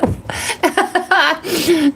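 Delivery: laughing high and helpless; high laughter. Real, not acted. Host still laughing at a viewer's joke, high laughter.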